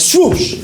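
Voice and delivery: high-pitched "swoosh" sound effect; excited comic-book swoosh; swooping whoosh. Acted. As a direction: voice a superhero diving down, high. Voices his own swoop down.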